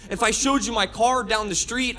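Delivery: ordinary talk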